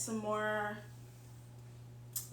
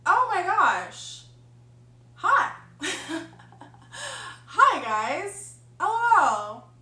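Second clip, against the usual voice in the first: excited greeting; 6 semitones higher, 10 dB louder, much slower than usual. Real, not acted.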